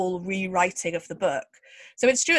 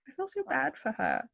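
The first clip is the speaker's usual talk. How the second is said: usual speaking voice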